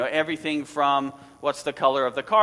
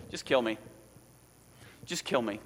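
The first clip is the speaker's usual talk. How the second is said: low, quiet, defeated plea; a hint of a despairing Jonah begging; weary Jonah; 4 semitones lower, 6 dB quieter than usual. Jonah to the sailors, mildly despairing. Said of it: "So what he says is this".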